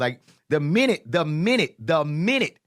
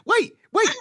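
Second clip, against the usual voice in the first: eager interruption; 4 dB louder than usual. Real, not acted. Cutting in to add more.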